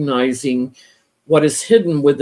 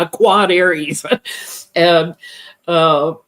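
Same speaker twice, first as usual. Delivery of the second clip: ordinary delivery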